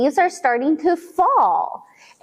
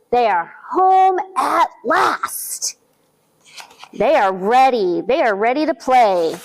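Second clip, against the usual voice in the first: ordinary delivery, nothing marked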